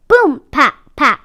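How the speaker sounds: ordinary delivery, nothing marked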